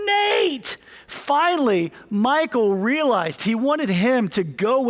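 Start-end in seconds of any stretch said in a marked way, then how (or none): 0.1-0.8 retold coach shout. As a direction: yell it down the bench, pitched high. Coach yells a third time.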